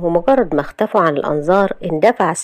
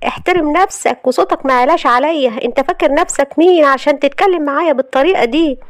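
higher, indignant anger; furious retort; 8 semitones higher than usual. Acted. Friend standing up to the rude husband, higher and furious. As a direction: stand up to him, offended and furious. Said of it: "وهي في قمة غضبها".

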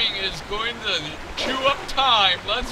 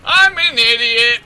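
loud, higher outburst; self-scolding; 5 semitones higher, 11 dB louder than usual. Real, not acted. Player realizes the steps were pointless, loud self-scolding. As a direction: blurt it loudly at yourself.

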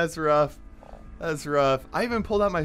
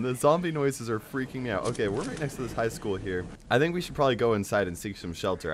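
ordinary talk, nothing marked

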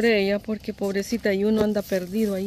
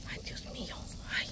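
whisper; 13 dB quieter, faster than usual. Real, not acted. Anguished over weak mother opossum.

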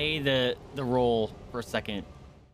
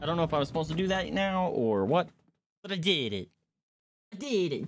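triumphant; 6 semitones higher than usual. Real, not acted. Just beat the boss.

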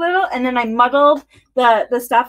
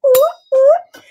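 high loud squeal of cold; high ooh; 13 semitones higher, 7 dB louder than usual. Real, not acted. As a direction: yelp at the icy cold. Hands freezing on the shaker.

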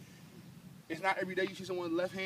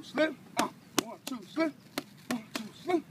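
coach calling combos; 8 semitones higher than usual. Real, not acted. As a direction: bark the count at the fighter. Coach counts punch-slip combos.

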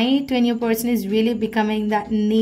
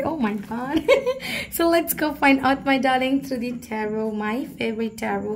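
ordinary talk, nothing marked

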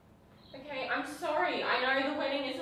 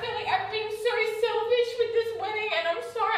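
higher and louder, voice cracking with a laugh; emotional, near tears; 8 semitones higher, 8 dB louder than usual. Real, not acted. Fiancée apologising for the wedding, emotional and near tears. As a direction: apologise while close to crying. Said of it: "she's freaking out".

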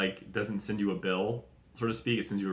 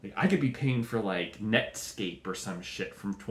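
usual speaking voice

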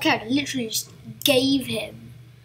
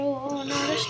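ordinary talk

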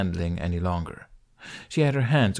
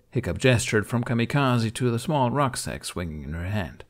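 ordinary delivery, nothing marked

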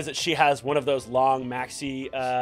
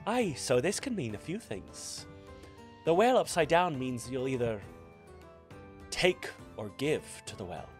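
fortune teller; 5 dB quieter than usual. Acted. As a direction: hush as the cards turn dark. Fortune teller reading, soft.

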